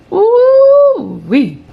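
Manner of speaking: high, loud exclamation of scandalised delight; woo-wee. Real, not acted. Vlogger reacting to the juicy scandal with a high, loud hoot. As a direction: hoot it like the gossip is too juicy. Gossip reaction to messy news.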